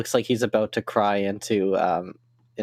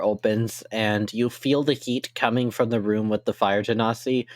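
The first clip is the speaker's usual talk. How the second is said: ordinary talk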